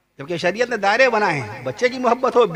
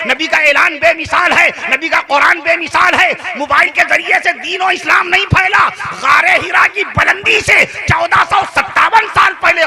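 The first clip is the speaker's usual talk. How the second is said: 1 preaching at full force; 6 semitones higher than usual. Real, not acted. Climactic preaching proclamation.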